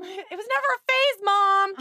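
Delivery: high, loud mock emo kid; emo teen whine.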